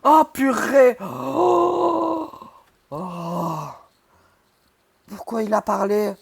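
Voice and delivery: loud, high frightened cries; scared horror game streamer. Real, not acted. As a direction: shout in real fright at the jump scare. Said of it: "Il m'a fait peur". (streamer effrayé qui crie)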